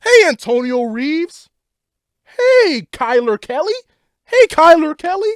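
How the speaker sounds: drawn-out high, loud mock hellos; slow sing-song mock greeting of each player; mock cheery greetings. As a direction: call each name out like a gushing owner. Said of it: "I don't think she'd be like".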